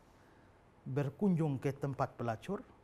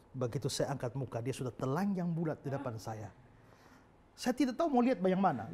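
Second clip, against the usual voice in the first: usual speaking voice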